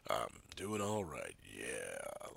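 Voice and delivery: dropped low, slow, croaky; deep croaky voice. Shown as a demonstration. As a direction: show off the sunken congested voice. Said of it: "listen to that".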